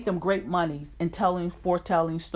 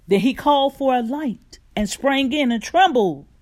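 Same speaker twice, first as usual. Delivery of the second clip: fervent rising scripture reading, louder; preaching scripture; 3 semitones higher, 5 dB louder than usual. Acted. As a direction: read the passage like a preacher building fire. Scripture read with rising force.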